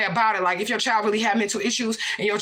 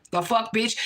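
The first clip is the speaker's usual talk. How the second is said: usual speaking voice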